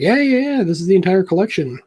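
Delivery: the artist proudly confirming, fast; eager artist. Retold or imitated. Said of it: "He's like".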